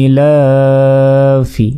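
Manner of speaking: ইলাফি chanted very slowly with a long vowel; ইলাফি recited slowly, vowel held long; drawn-out recitation. Shown as a demonstration. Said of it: "একালিক পরিমাণ টেনে পড়তে হয়".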